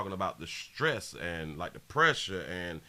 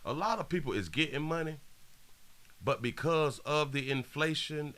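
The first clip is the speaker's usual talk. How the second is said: higher restart; 6 semitones higher than usual. Shown as a demonstration. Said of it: "Watch this, watch this".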